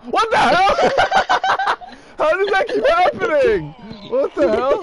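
high, loud shouting through laughter; laughing disbelief. Real, not acted. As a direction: cry out incredulously while cracking up. Laughing disbelief at game mishap.

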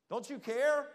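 loud, high panicked cry; frantic shout. Shown as a demonstration. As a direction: yell it in panic over the storm. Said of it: "I think there was some excitement".

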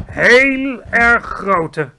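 proudly. A narrator's reading. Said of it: "zei het hoofd van de luchtmacht trots".

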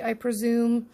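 normal talking voice

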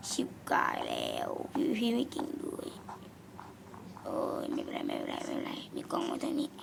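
voiceless whispered self-talk. Real, not acted. Gamer worried about PvP, whispering to himself. Worried muttering during game.

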